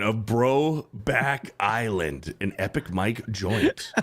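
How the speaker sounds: slow, dramatic trailer voice; lightly voiced trailer narrator; movie trailer voice. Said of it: "in movie trailer voice".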